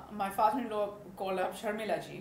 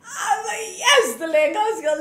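much louder, high relieved cheer; joyful cry; 10 semitones higher, 12 dB louder than usual. Retold or imitated. Bride cheers as her wedding outfit arrives.

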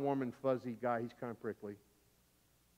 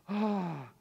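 long, higher-pitched gasp; gasp of relief; 5 semitones higher than usual. Retold or imitated. Boy safe on the other side of the creek, gasping. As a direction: let out a drawn-out "ah". Acts gasp of being saved.

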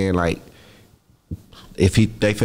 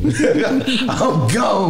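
high laugh through the words; giggling while repeating the line; 11 semitones higher than usual. Real, not acted. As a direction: laugh it out, voice jumping up.

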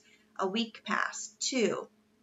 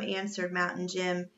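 usual speaking voice

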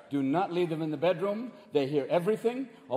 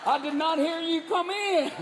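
hammed-up small-town Southern holler; Southern yokel; 8 semitones higher than usual. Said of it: "especially in the South".

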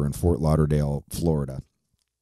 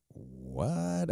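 shocked; 6 semitones higher, 11 dB quieter than usual. Retold or imitated. Shocked by tour news.

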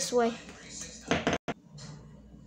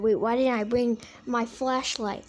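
ordinary delivery

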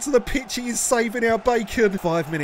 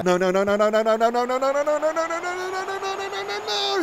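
no repeated, climbing steadily higher; rising panicked no; 8 semitones higher than usual. Real, not acted. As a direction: let the panic build with each no. Watches a dangerous attack.

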